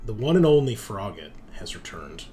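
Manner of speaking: ordinary delivery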